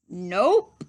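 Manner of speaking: громкое хоровое «Nope» высоким голосом класса; loud nope. A narrator's reading. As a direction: class shouts a flat nope. Whole class answers.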